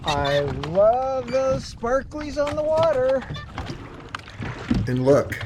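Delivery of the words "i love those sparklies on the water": high, slow, sing-song delight. Real, not acted. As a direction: coo over the sparkles. Delighted at sparkles on water.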